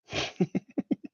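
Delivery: short high breathy chuckle; amused little laugh. Real, not acted. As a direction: chuckle to yourself mid-story. Amused at his own story.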